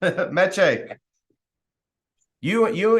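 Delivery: usual speaking voice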